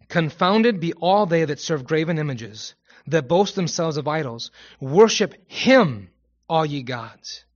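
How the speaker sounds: emphatic, declaimed psalm verse; formal Bible reading with drawn-out emphasis; scripture reading. Acted. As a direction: proclaim it, punching the command. Reads a Psalm aloud.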